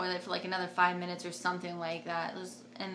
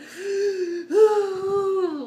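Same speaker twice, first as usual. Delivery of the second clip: loud, high drawn-out gasp imitating panic; high panicked gasp; 11 semitones higher, 10 dB louder than usual. Woman in labor as contraction comes, imitated panicked gasp. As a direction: imitate freaking out with long, high breaths. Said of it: "like, freaking out".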